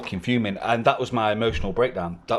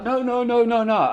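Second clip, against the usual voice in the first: high, urgent mock pleading; rushing in to stop a fight, in a retold high voice; frantic pleading; 9 semitones higher, 4 dB louder than usual. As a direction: girlfriend pulling two men apart, a high imitated plea. Girlfriend breaks up fight.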